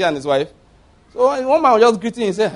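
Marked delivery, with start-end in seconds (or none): none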